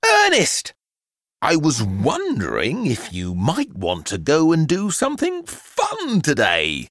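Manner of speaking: mother's high, coaxing voice; plucking up courage. A narrator's reading. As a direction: start high on the name, then ask gingerly. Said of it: "plucked up the courage to ask".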